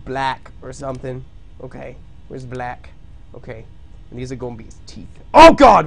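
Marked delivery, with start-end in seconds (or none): 5.3-5.9 high, loud sudden yelp; startled cry. Real, not acted. Streamer startled by the drawing. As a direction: blurt it out in shock.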